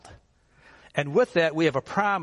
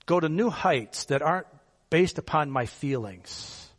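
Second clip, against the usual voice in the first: usual speaking voice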